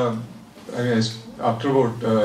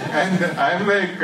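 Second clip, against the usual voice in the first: laughing while talking; 7 semitones higher, slower than usual. Real, not acted. Laughing through the punchline.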